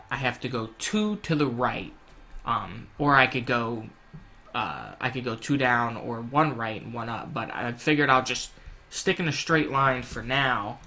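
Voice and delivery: ordinary delivery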